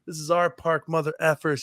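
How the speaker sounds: imitating a park woman's screamed curses; retold screaming. Woman in the park yelling at the narrator, high mock scream. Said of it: "starts screaming at us and mother effing us".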